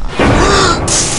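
high, drawn-out scared squeal; scared shriek. Real, not acted. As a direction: shriek at the jumpscare. Jumpscare, voice breaks high.

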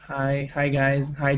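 usual speaking voice

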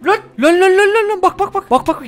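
jumpy gamer caught off guard; startled yelps. Real, not acted. Gamer spotting an enemy, startled high yelps.

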